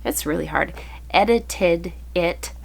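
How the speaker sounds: normal talking voice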